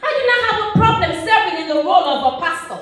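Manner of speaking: high, insistent, repeated protest; impassioned objection to a stranger; emphatic protest. Acted. Protests to the man at the well.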